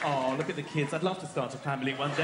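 low, wistful passer-by; dreamy childless couple. Acted. Childless couple watching the family drive past, wistful. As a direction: say it low and naively longing. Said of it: "a childless couple is driving past".

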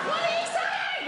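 put-on very high child's shout; child calling back. Child to father on the motorway, high shout.

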